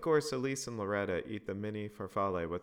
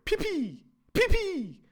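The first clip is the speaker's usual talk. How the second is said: put-on very high loud kid holler; shrieking little-girl holler; hollering child; 11 dB louder, slower than usual. A narrator's reading. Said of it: "Loretta hollered".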